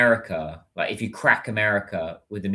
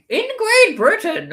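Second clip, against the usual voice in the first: very high, slow, hammed-up posh British voice; campy British character; 7 dB louder, slower than usual. Vlogger showing off his campy British persona, hammed-up posh voice. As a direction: ham up a plummy toff, slow and sing-song. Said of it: "funny British character, campy character".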